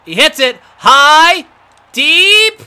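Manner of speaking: high, loud rising call as the ball flies; excited home run call. Real, not acted. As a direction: sell the drive like a live announcer. Calling a deep fly ball.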